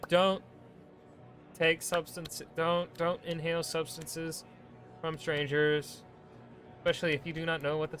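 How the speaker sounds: mock warning. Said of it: "mock safety warning".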